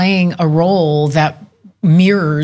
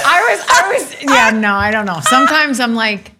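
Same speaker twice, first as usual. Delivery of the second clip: ordinary talk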